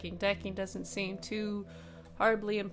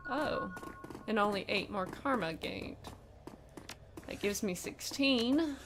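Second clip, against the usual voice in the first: ordinary delivery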